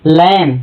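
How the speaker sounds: लैम with the final B dropped; silent B. Shown as a demonstration. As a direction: say लैम ending on M, no B. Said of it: "B silent है इसलिए". (लैम, ब silent, म पर ख़त्म)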